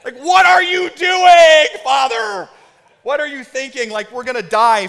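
very high, loud imitated toddler shriek; screaming toddler. Toddler to his dad while running through rain, mock panicked scream. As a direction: shriek it like a panicked small child. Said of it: "I hear him go".

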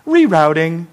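lightly voiced, kindly navigation voice; mild imitation of a sat-nav lady; gentle GPS lady. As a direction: announce it gently, without any scolding. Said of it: "in a nice, gentle voice".

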